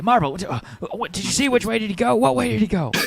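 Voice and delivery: high, fast, urgent thug asking directions; a flustered brute demanding which way. Acted.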